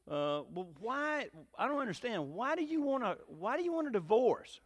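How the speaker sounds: retold puzzlement, pitch pushed up; baffled lawyer. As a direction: press for an answer, mildly baffled. Baffled lawyer questions divorcing client.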